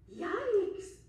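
startled yell. Acted. Cass startled by the grab, yelling loud. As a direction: jump and yell out. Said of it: "yelled Cass".

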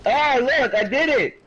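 high, loud gleeful announcement; excited triumph. Real, not acted. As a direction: crow about pulling it off. Celebrating success in game.

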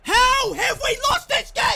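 high, very loud incredulous shout; outraged yell. Real, not acted. Player who cannot accept losing this game, outraged yell. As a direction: erupt in real fury at the defeat. Explodes after the defeat.

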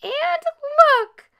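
put-on very high, loud baby bear; piping little bear voice; little bear squeal. A narrator's reading. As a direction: squeal it like a tiny bear cub. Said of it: "squealed Little Honey in his little bear voice".

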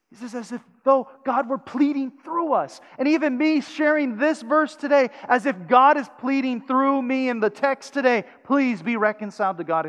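loud, high, urgent plea; preaching at full force. Acted. Pastor urging listeners to reconcile, loud preaching. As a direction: lift the plea to the congregation.

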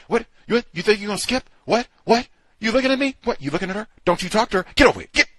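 high, loud rapid-fire challenges; mock aggressive confrontation. Host mimicking aggressive staff on the first day, loud and combative. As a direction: imitate a staffer picking a fight with kids.

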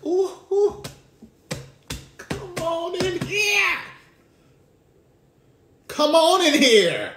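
high, drawn-out, sung-out call; ecstatic preacher cry. Acted. Preacher calling out to the church, high and drawn out.

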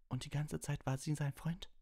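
high, strained accusation; voice out of control. A narrator's reading. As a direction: cry it out in angry disbelief. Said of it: "seiner Stimme nicht mehr Herr".

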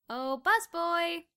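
slow, high sing-song jeer; snooty drawn-out call for the busboy; mocking call. A narrator's reading. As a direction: drag it out like a snooty diner.

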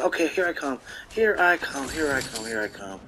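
low murmur fading away; stalking murmur. Real, not acted. Player creeping up on an opponent, stalking murmur.

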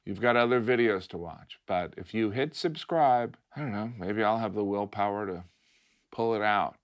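soft, mock-pitiful appeal; gently guilt them into subscribing; outro plea. Comic asking viewers to subscribe, soft and mock-pitiful.

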